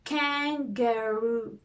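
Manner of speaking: kan-ga-roo drawn out slowly by syllable; slow syllable beats. Shown as a demonstration. Teacher tapping kangaroo out with the hand, slowly. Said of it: "do it with the hand first".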